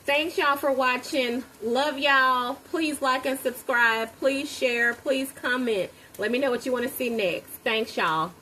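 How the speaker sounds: upbeat outro. Real, not acted. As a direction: wrap up the video with cheer. Vlog outro sign-off.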